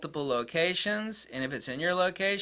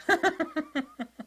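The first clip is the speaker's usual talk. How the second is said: high chuckle; 12 semitones higher than usual. Real, not acted. Laughs at comedian joke.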